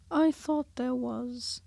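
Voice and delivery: slow placid bear; unbothered, taking his time; placid Pooh. A narrator's reading. Pooh answering Piglet, slow placid voice.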